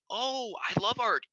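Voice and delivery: high, slow, gushing delight; mock enthusiasm. Doctor acting out praising a teen's art, slow gushing. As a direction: sell it like you adore their art.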